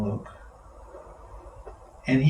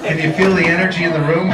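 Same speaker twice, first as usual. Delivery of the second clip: excited; 4 semitones higher, 7 dB louder, faster than usual. Real, not acted. Excited call to the room.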